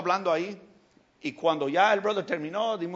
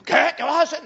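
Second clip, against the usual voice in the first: high, loud startled question; wife reacts when told about the three-hundred-dollar gift; wife surprised; 8 semitones higher, 8 dB louder than usual. Retold or imitated. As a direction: react in shock at the plan.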